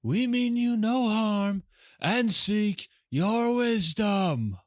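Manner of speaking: high, slow, sustained proclamation; ringing wizard's call. Acted. Wizard pleading peace to stone creatures, high ringing call. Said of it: "her voice rings out".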